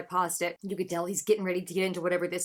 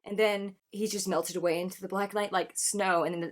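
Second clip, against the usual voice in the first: ordinary talk, nothing marked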